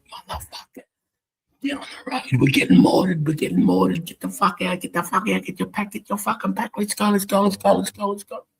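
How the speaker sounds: retold corporal bawling at sleeping recruits to move; corporal yelling. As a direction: rouse the section like mortars are landing. Corporal shouting to wake men.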